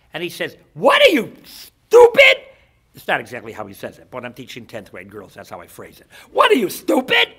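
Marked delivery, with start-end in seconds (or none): 0.7-2.4 shouted, high-pitched outburst; acted-out royal rant at full volume; ranting king. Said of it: "the king flips out at him". 6.3-7.3 high, loud acted outburst; incredulous shout, pitched way up; ranting king. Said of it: "like a rant".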